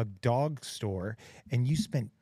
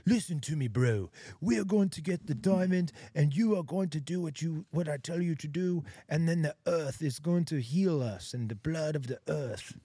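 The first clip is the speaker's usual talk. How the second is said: higher, intense movie-hero voice, slowing at the end; Leonardo DiCaprio; 3 semitones higher than usual. Retold or imitated. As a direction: play a dramatic action-film lead giving orders. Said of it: "and he's like".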